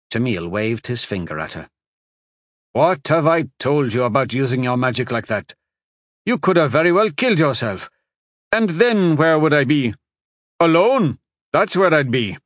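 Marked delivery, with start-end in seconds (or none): 2.7-12.4 loud, fast, rising fear-filled scolding; angry worry. Acted. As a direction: berate her out of fear. Husband scolds wife.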